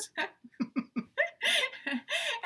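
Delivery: a sudden high squeal of a laugh; high burst of laughter. Real, not acted. Wife at husband's joke, squealing laugh.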